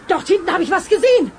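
ordinary talk

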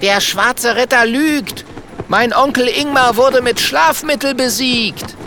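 sehr hohe, rufende Kinderdrachenstimme; rufender kleiner Drache. Acted. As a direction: rufe die Anklage hoch hinaus. (hoher, empörter Ruf eines kleinen Drachen)

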